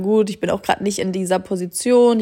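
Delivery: normal talking voice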